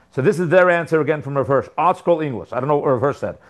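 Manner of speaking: normal talking voice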